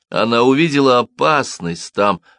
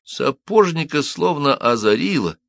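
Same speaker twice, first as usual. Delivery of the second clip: ordinary delivery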